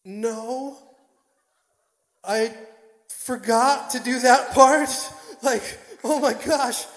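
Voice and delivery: high, drawn-out loud wail; slow comic whine, very high; mock anguish. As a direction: lament a missed chance, hammed up. Retold anguish at forgetting the photo.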